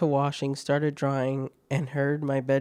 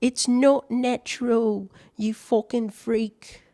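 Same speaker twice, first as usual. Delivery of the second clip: put-on disgusted girlfriend; 8 semitones higher, slower than usual.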